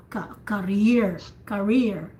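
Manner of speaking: career said with a weak, near-whispered ka and a strong rear; careful teaching demo of career, second syllable stressed. Shown as a demonstration. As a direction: drop the ka in career and hit rear. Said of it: "it's like you whisper the first syllable".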